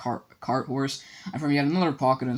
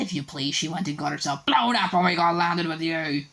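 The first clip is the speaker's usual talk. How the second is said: ranting; 3 semitones higher than usual. A narrator's reading. Aunt Petunia rants at Harry about his parents, high and bitter. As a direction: spit it out with years of spite. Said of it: "went ranting on".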